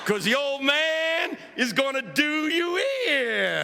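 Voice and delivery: slow, soaring preacher's boast; drawn-out, high, swelling threat in jest; old pastor's boast. Retold or imitated. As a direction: stretch the words like a showboating preacher. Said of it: "He said".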